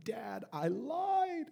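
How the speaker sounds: high, drawn-out, soft wail; bawling child imitation. Daughter confessing to dad, mock bawling. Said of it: "she's bawling".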